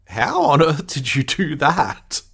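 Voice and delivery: high, slow, wide-eyed amazement; Data stunned by the trick; amazed Data. Retold or imitated. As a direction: drag it out in disbelief. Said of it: "and Data's like".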